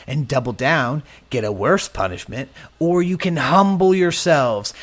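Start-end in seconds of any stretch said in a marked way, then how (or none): none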